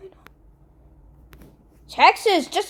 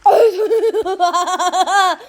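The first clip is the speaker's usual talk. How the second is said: a cackling villain laugh, louder than her talk; evil laugh; 3 semitones higher, 4 dB louder than usual. Acted. Gamer playing a map game lets out an evil laugh. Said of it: "the most evil laugh I've ever heard".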